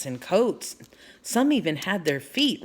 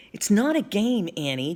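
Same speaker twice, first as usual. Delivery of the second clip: usual speaking voice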